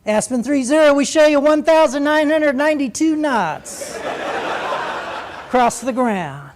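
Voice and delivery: high, loud sing-song radio announcement; sing-song controller voice. Retold or imitated. Controller obliged to read out a staggering speed, sing-song. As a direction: lift the whole readout up into a ringing sing-song. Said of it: "had to give you that same voice".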